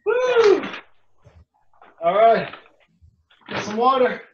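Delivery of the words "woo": loud, high cheering whoop; excited whoop. Real, not acted. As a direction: whoop for joy at finishing. Cheering at end of set.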